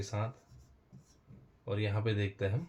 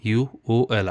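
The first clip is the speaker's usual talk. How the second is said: slow spelled rule; 7 dB louder, much slower than usual. Shown as a demonstration. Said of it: "जब सी के बाद क्या आता है?".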